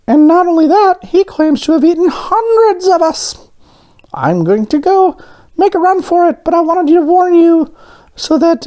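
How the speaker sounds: very high-pitched little donut voice; alarmed donut character. A narrator's reading. Arnie warns others about the man who tried to eat him, high squeaky alarm. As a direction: squeak out an urgent warning in a high cartoon voice.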